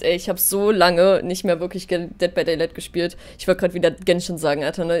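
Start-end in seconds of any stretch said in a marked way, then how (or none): none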